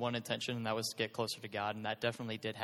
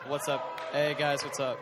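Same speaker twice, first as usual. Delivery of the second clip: shouted hey guys; loud greeting; 4 semitones higher, 6 dB louder, slower than usual. Real, not acted. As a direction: call out to the room. Greeting crowd into mic.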